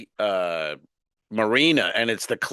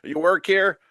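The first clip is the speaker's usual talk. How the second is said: mock nosy passengers; 6 semitones higher than usual. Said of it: "people go".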